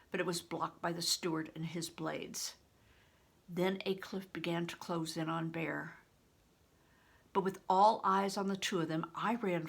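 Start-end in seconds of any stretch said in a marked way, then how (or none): none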